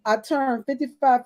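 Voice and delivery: ordinary talk, nothing marked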